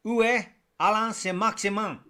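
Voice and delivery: hammed-up mock French accent. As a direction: deliver it in your best show-off French accent. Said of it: "That was my best French accent".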